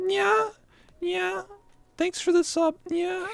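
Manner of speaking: put-on squeaky high cat girl maid; high-pitched, cutesy streamer thanks. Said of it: "the impression's like".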